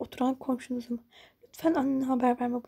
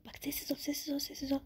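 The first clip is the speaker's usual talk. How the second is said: urgent hushed whisper, much softer; frantic whisper; 11 dB quieter, faster than usual. Acted. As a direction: shush her fast. Hushing after the cheer.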